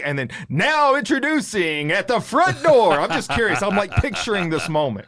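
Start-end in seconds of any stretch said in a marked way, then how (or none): 0.5-2.9 slow, drawn-out announcer call; mock ring announcer. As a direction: announce the wife's husband at the door. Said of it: "do you ever announce yourself when you come home".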